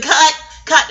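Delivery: mock director halting the shot; director calling cut. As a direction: stop the take like a film director. Director stops the scene.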